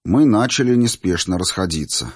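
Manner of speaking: plain reading voice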